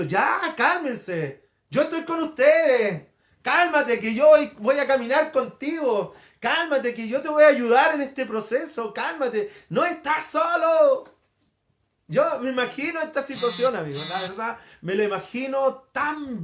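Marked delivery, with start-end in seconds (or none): 0.0-11.0 raised, emphatic reassurance, building at the end; insistent comforting call over the panic; Jesus calming us. Acted. Preacher as Jesus beside those who despair, emphatic calming. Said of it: "y Jesús está al lado diciendo".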